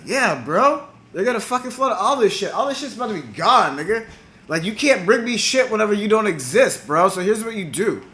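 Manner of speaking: high, insistent voice of a tipster; urgent warning. Retold or imitated. Enki warns friend of flood.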